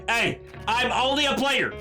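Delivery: normal talking voice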